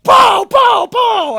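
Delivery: very high, very loud pows; wild full-voice sound effect; screamed banging imitation. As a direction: scream out each blow. Imitating hitting the air conditioner.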